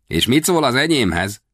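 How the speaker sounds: put-on high boy's question; boy proudly presenting his pistol. A narrator's reading. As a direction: show off your toy with pride.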